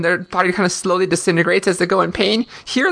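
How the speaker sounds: usual speaking voice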